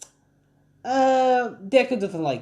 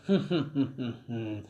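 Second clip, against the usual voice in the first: closed-mouth mock villain laugh, fading softer; low-key acted evil chuckle; 3 semitones lower, 7 dB quieter than usual. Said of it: "All For One starts laughing".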